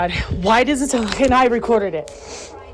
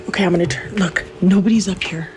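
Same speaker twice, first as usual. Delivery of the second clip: normal talking voice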